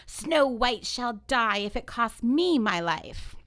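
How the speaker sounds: slow, loud queen's vow of fury; enraged yell. Acted. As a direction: declaim it loudly, drawing out the threat. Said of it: "and she yells".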